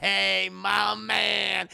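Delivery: loud, very slow, drawn-out hail; gleeful hail. Retold or imitated. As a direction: stretch each word out in mock triumph. Eyeball hails its new ally.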